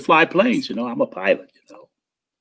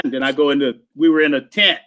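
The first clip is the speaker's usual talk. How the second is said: ordinary talk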